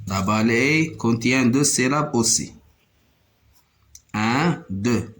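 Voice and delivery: normal talking voice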